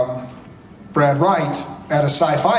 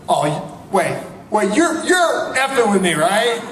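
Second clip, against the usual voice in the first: sudden suspicion; 4 semitones higher, slower than usual. Retold or imitated. Producer realises the husband is teasing him: higher disbelieving outburst. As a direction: catch on mid-panic, half laughing. Producer suspects he's being teased.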